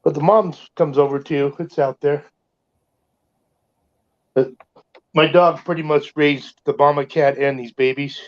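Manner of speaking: ordinary delivery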